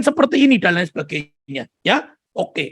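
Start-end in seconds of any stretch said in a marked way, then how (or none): none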